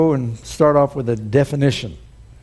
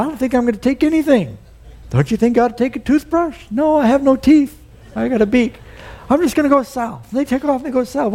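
chatty birds; 8 semitones higher than usual. Acted. Two birds chatting about migrating.

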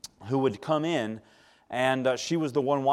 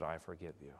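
softer, tender, moved; quiet tender forgiveness; 7 semitones lower, 15 dB quieter than usual. Retold or imitated. Nurse with a tear in her eye forgiving her patient, quiet and tender. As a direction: let it come out gently, near tears.

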